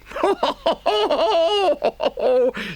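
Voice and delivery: very high gleeful Toad laugh; helpless laughter. A narrator's reading. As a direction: cackle in uncontrollable triumph. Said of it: "he laughed till he had to".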